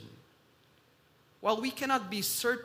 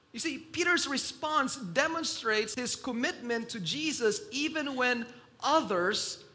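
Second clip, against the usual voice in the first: usual speaking voice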